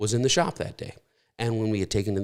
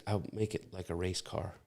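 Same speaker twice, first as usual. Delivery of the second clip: much softer, slightly lower quoted reply; retold sheepish son; 3 semitones lower, 14 dB quieter than usual. Son owning up to Dad about the scratched car, retold quietly. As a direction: say it small and quiet, imitating a child in trouble.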